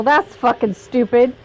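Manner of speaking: ordinary delivery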